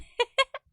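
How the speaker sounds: high giggle through pain; pained laugh. Real, not acted. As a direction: laugh off the sting. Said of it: "I bit my tongue".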